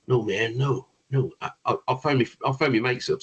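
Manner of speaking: lightly put-on American voice; pretend American accent. American telling the band he'll phone his mates, in a pretend American accent. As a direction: attempt an American accent, loosely. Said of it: "Sorry about me pretending American accent".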